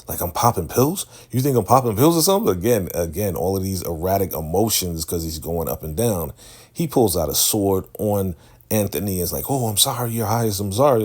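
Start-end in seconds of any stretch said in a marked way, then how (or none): 0.1-2.5 high-pitched, rushed offended questioning; indignant disbelief. Retold or imitated. Offended leader challenging his follower about pills, indignant disbelief. 9.5-11.0 higher, increasingly loud hurried pleading; mock panicked begging, building in volume; pleading apology. Servant begging the sword-wielding Highness, mock frantic apology. Anthony begs with sword drawn on him.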